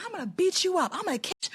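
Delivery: high-pitched mock threatening voice; mimicked threat. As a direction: imitate an unhinged woman's empty threats.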